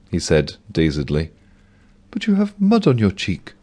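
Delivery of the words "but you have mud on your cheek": higher voiced bank clerk, subdued; Mavis voice. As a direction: play the tired Mavis, much higher.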